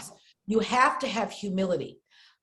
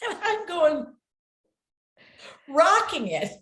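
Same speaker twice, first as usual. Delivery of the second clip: high, laughing recollection; 9 semitones higher, slower than usual. Real, not acted. Recalling her sound booth singing, laughing. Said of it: "laughing while recalling singing".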